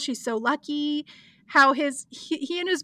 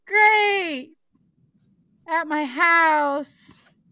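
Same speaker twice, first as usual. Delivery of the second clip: mock enthusiasm; much slower than usual. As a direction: stall awkwardly while pretending to be pleased. Acting Fiona's awkward reply.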